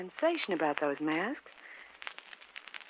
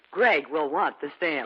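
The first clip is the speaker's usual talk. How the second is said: slow, lower mock Greg voice; imitating Greg; 6 semitones lower, slower than usual. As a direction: lightly quote Greg's eager words, slowed down. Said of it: "That was the first thing he said".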